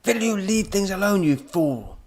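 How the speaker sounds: high, loud exasperated shout; angry shout. A narrator's reading. Professor to fiddling robot, angry shout. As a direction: shout the scolding, pitched high. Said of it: "the professor shouted".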